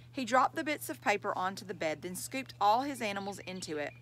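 plain reading voice

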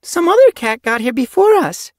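very high, loud and upset; dismayed young apprentice. A narrator's reading. Young she-cat finds only feathers left, dismayed. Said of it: "with a look of dismay".

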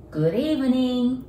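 slow, careful "Good evening" with each sound clear; slow English demo; slow model phrase. Shown as a demonstration. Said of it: "これは、こんばんはという意味".

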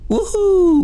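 a high, loud whoop of joy; celebratory game win whoop. Real, not acted. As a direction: cheer it out loud into the mic. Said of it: "je gueule dans le micro".

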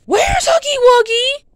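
high, loud, stretched question; alarmed cry. Pretend. Noticing the monster has gone, alarmed cry.

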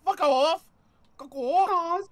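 high, loud squawking fuck off; mock a friend's squeaky snap; imitating Kitty twelve fifteen. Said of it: "Kitty twelve fifteen sounds like".

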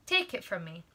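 plain reading voice

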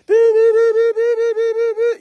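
high snake-charmer flute noise; high piping toot-toot of a flute; imitated flute. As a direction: mimic a little flute with a high, steady, pulsing tone. Mimics snake charmer's little flute.

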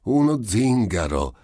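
high, loud exclamation; amused cry. A narrator's reading. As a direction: cry out as if hugely entertained. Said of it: "gridò il medico mettendosi a ridere".

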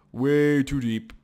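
slow, emphatic repeat; drawn-out emphasis. Real, not acted. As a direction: stretch the words out for emphasis.

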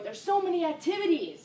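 high-pitched, excited movie quote; Step Brothers quote. Acted. Dance teacher quoting Step Brothers for the routine, high-pitched quote. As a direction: pitch it up high, landing hard on "so many". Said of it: "did the Step Brothers quote".

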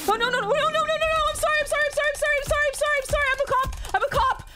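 high, frantic stream of apologies; panicked pleading. Real, not acted. Gamer startled in a horror game, pleading in panic. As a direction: beg in real panic, voice up high.

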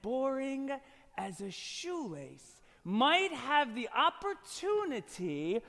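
slow, soft, drawn-out mock-solemn delivery; hushed showman's wonder. Magician marvels at a simple shoelace trick, slow and hushed. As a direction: stretch each phrase for suspense.

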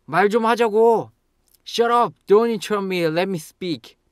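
high, loud, frustrated shout; angry outburst. Shown as a demonstration. Interrupted speaker to interrupter, fed up. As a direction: shout them down, easing off at the end. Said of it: "if they keep interrupting you".

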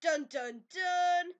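loud mock suspense sting; dramatic "dun dun dun". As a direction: voice a big dramatic reveal fanfare. Spoken drumroll before a name.

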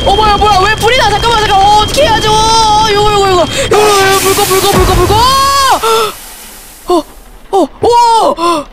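high, rapid panicked repetitions stretching into a yell. Real, not acted. Player panicking as the fire breaks out, high frantic yelling.

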